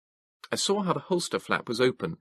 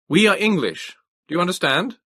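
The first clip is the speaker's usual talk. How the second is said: louder, higher call across the road; 10 semitones higher, 8 dB louder than usual. A narrator's reading. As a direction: make him understand, hands raised. Calling out to trooper.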